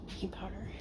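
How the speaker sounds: whispered under the breath; whispering. Real, not acted. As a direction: mutter it to yourself in a whisper. Muttering wrong guess.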